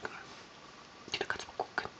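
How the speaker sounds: soft tender whisper. Real, not acted. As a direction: breathe it to the little one. Whispering to the pet.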